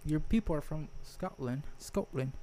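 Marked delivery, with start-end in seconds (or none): 1.8-2.3 Scottish accent. Shown as a demonstration. Teasing a friend about Scottish roots, Scottish accent.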